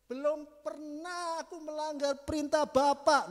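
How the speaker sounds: aggrieved elder son. Acted. Elder son protesting to his father, loud and slow. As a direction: declaim it as the wronged older brother. Elder son's complaint read aloud.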